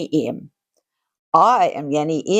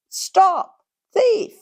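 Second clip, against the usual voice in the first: calling out; 12 semitones higher than usual. Acted. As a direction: yell it after the fleeing rabbit. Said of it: "calling out".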